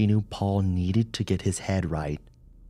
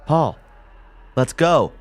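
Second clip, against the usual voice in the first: higher, louder call; urging; 9 semitones higher, 8 dB louder than usual. A narrator's reading. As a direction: hurry him along firmly. Urging partner.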